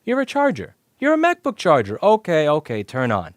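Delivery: high, loud, mock-cheerful voice of the controller chip. Teacher voicing the controller chip welcoming the charger, high and loud. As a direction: play the chip greeting the charger, high and bright. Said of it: "It's like".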